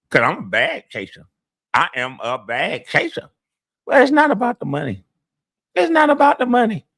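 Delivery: mocking boastful impression, swinging high; exaggerated stiff-mouthed braggart, pitch leaping up; Fire Marshal Bill mouth. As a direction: hide your top teeth and crow like a self-appointed winner. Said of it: "I did that Fire Marshal Bill shit".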